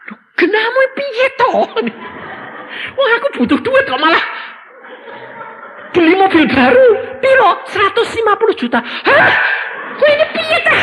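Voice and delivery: high, loud, fast whiny son laughing in disbelief; exasperated son. Retold or imitated. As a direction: squeal it out as the flabbergasted son.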